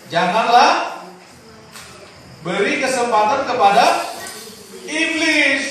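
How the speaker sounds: preaching at full force. Acted. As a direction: build the scripture up to a preacher's shout. Reads verse with rising force. (pendeta membacakan ayat kepada jemaat, makin keras dan tinggi)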